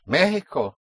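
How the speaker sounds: Mexico said the Spanish way, MEH-hee-ko; Mexico with a punched first syllable and an H sound for X; stress on first syllable. Shown as a demonstration. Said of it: "you have an accent over the E".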